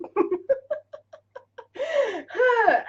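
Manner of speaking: high, helpless laughing fit; real uncontrolled laughter; laughing to tears. Real, not acted. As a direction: laugh until you well up. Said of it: "you've got me all teary".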